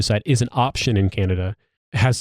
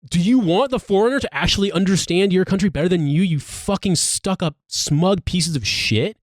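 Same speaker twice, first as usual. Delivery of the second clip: pitched up, slowing into a furious tirade; angry rant; 8 semitones higher than usual. Real, not acted. To smug British critics, rising and slowing into an angry tirade.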